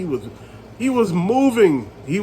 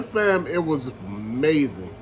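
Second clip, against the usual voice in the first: ordinary talk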